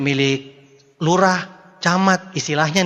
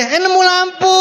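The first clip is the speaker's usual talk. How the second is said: high, loud, laughing mock discovery; high laughing mock "Eh, nemu lampu" as if stumbling on it; mock surprised find; 12 semitones higher, 6 dB louder, slower than usual. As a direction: play a fool who trips over a lamp, laughing. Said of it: "Bukan pas jalan itu ada".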